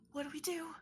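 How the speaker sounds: urgent whisper. Acted. Ronnie asking the group quietly, urgent whisper. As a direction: breathe the question out nervously. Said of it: "Ronnie is like".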